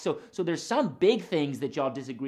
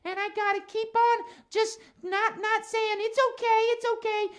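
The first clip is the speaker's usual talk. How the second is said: higher-pitched puppet voice, earnest complaint; Max the cat. Acted. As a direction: play a cat puppet airing a grievance.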